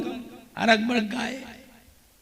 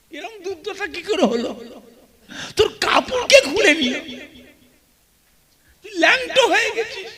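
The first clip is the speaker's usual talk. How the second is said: mother's shocked lament; 8 semitones higher, 5 dB quieter, faster than usual. Acted. Mother shocked at naked son.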